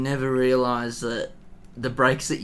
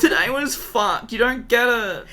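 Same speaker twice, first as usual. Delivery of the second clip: put-on much higher, slow sulky teen voice; higher, slower voice of a teen sulking after school; 10 semitones higher, 4 dB louder than usual. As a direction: complain like a moody teenager. Mocking a sulky kid.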